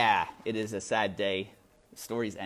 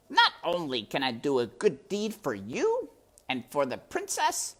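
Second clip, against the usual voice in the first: high, purring villain rat, mockingly weary; a higher, silky rat who finds it all tiresome; sly rat voice; 11 semitones higher, 5 dB louder than usual. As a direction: play the sly rat toying with the mouse. Rat Botticelli's voice in story.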